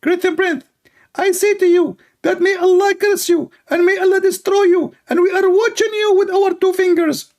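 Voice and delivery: squeaky parody of an angry preacher; high, squeaky preacher voice; Ali Dawa. Acted. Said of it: "Ali Dawa".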